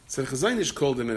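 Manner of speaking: usual speaking voice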